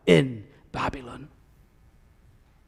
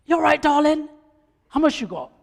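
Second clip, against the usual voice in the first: a lightly voiced market seller, higher and eager; market vendor; 10 semitones higher than usual. Vendor calling to a shopper at the Friday market, eager sales pitch. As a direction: hawk your goods to a passing shopper, a touch of chirpy trader. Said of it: "hawking wares with enthusiasm".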